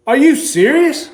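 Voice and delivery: retold high, incredulous question; stunned disbelief. Told the car is his, asking Sean in disbelief. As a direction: pitch it up in sheer disbelief.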